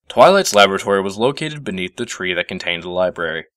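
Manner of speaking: ordinary delivery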